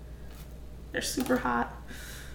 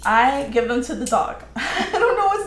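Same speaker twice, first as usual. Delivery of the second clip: laughing; 4 semitones higher, 6 dB louder than usual. Real, not acted. Confessing to viewer, laughing.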